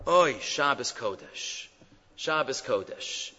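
slow, hushed, tender lament; loving, tearful compassion. Retold or imitated. Chafetz Chaim crying with the boy, slow and tender. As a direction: sigh it out softly with love, as if crying. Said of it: "With a love, with a, with a hug".

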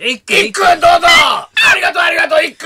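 high, loud mock shout; imitated fan cheering. Fan in the front seats hailing the player, imitated excited shouting. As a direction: call out like an overexcited supporter.

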